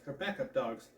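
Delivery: usual speaking voice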